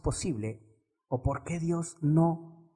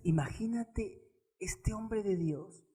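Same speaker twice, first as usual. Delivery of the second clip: ordinary talk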